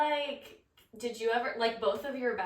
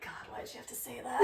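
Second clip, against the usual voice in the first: soft, breathy whispered complaint; 3 semitones higher, 8 dB quieter than usual. Retold or imitated. Friend recalling being moved by the bride's vows, whispered. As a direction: whisper it, half moved, half scolding.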